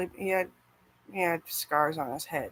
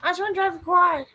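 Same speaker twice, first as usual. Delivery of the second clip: put-on high, loud childish whine, louder; little kid voice; 12 semitones higher, 9 dB louder than usual. As her small child self begging to ride, a high loud whine. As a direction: play a small kid begging to drive a quad. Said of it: "I was a little kid and I was like".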